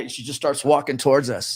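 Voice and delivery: ordinary delivery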